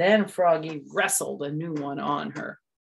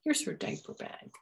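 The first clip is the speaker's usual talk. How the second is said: whispered handover; quiet whisper; 2 semitones higher, 8 dB quieter than usual. Acted. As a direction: hush it gently. Mom quiet.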